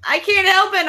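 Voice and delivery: high, loud giddy squeal; can't contain the excitement; excited. Real, not acted. Host squealing at her guests, high and excited. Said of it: "I'm just so excited".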